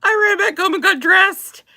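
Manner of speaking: high, loud squeal straight after a laughing fit; helpless amusement. Real, not acted. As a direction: squeal out the punchline, cracked up. Cracked up, repeats her punchline.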